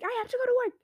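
very high, loud wail of leaving for work; a mock high-pitched complaint; high whiny friend. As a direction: whine it in a squeaky friend voice. Kitty voiced leaving for work.

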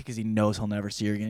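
ordinary talk

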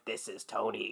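put-on low, quiet gravelly little boy voice. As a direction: rasp it out like a creepy kid. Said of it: "talks in a gravelly voice".

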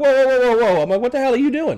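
high, loud whoa to halt someone; alarmed protest. Retold or imitated. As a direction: imitate yourself cutting in, startled and indignant. Retells stopping the interfering deputy.

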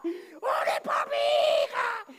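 very high, loud begging; desperate shouted plea. Retold or imitated. Woman falling at the front begs the pastor, desperate shouting. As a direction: shriek for prayer. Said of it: "que venga una mujer y caiga acá".

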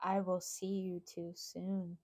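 quiet, slow, lightly voiced threat; a hint of a calm, real threat; adult voice. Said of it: "She said it in an adult voice".

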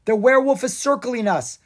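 urgent yell to friends. Acted. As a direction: shout the warning to the group. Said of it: "Drake yelled".

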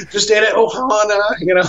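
high-pitched, put-on woozy contentment; food drunk. Food-drunk husband chatting to a passing saleslady, high and giddy. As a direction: play it happily stuffed and half gone. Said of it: "I'm basically food drunk".

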